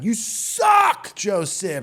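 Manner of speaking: high, loud mock jeer, peaking on the insult; scary heckler yell. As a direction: imitate a gnarly heckler yelling down from the balcony. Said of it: "just yells down".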